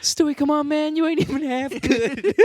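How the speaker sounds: mock talent contest. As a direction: ham up an exasperated put-down. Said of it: "It's just [name]'s, like, drunk".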